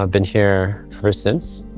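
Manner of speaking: ordinary delivery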